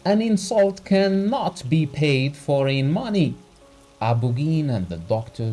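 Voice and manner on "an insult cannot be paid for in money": high, loud indignant; angry. Acted. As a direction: fling it back. Doctor continues shouting.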